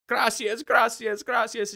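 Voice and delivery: so glad to get out; relieved thanks. Retold or imitated. To the driver, gushing relieved thanks. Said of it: "we're both just like".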